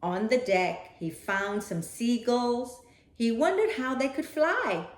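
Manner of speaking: ordinary delivery, nothing marked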